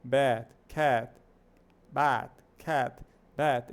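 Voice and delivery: bat and cat with a wide open a between a and e; bat, cat com a bem aberto, quase um A com E. Shown as a demonstration. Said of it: "é quase um A com E".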